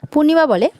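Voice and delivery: plain reading voice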